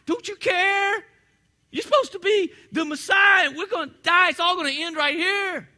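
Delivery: high, loud, frantic shout; terrified disciples yelling in the storm; panicked disciples. Acted. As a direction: scream it like the boat is sinking. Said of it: "I think they say".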